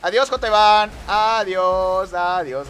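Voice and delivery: loud, drawn-out goodbyes; taunting farewell. Real, not acted. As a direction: call out a long, loud send-off to a rival. Waves off a passed rival.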